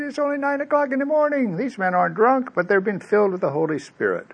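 high-pitched protest in Peter's mouth; acted protest. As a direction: raise the voice high and keep it light, easing down. Peter protests they aren't drunk.

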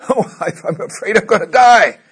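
high, loud, frightened dying patient's voice; mock panicky patient. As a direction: play a scared patient clinging to life, hammed up. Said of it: "they're freaking out".

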